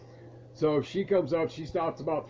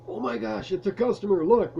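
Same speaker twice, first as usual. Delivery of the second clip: higher, louder, eager burst at spotting a customer; gleeful little alarm, mostly a touch louder; excited; 7 semitones higher, 5 dB louder than usual. Retold or imitated. Bored clerk to coworker, excited.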